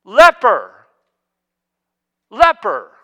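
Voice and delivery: loud, drawn-out warning cry; a loud mournful cry of leper; leper's call. Acted. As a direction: call it out so everyone keeps away. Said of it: "they had to declare".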